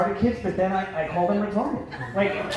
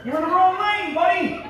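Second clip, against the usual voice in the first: higher, laughing Philly heckle at a stranger; grocery-line stranger mocked with a higher laughing Philly jeer; mock Philly heckler; 10 semitones higher than usual. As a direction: razz the guy with the apples, laughing. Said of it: "Guy could be just buying apples".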